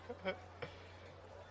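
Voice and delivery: a quick real laugh; real laughter. Real, not acted. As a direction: laugh at the officer being scolded. Laughing at his own story.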